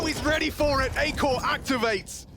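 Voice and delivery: high, loud frantic hype; esports caster. Real, not acted. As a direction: shout the clutch play by play. Clutch moment called live.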